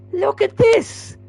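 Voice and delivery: slow, very high squeal; laughing disbelief. Real, not acted. Gamer showing the slide on replay, high laughing squeal. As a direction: squeal it in helpless amusement. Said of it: "from laughing at that".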